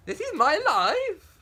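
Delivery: high, loud, slow rising wail; hammed-up outraged cry; mock despair. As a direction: melodramatic protest ending on a rise. First tragic protest.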